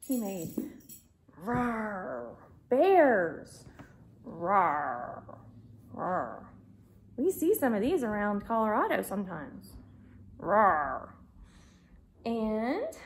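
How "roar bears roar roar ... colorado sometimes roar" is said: low bear roar. Pretend. Bear toy growls.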